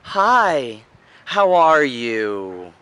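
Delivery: high, stretched-out pleading, pitched right up; trying for rapport. Shown as a demonstration. Coach demonstrating trying for rapport, upward pleading tone. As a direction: beg sweetly upward, drawing out the words. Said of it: "trying for rapport, which goes up".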